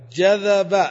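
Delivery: teacher's loud example; emphatic demo word. Shown as a demonstration. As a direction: hit جذب loud and clear. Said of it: "تقلب".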